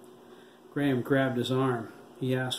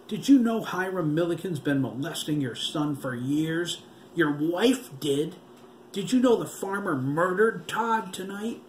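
high, louder, cruel taunting voice; taunting zombie boy; 6 semitones higher, 3 dB louder than usual. A narrator's reading. Gloating, cruel and high. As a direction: undead killer taunting the grieving husband. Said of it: "Terry screamed".

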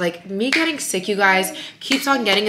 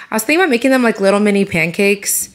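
ordinary talk, nothing marked

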